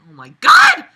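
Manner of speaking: squealed high and loud; shrieking oh my God; exasperated outburst. Real, not acted. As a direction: cry out in disbelief.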